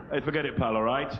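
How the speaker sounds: usual speaking voice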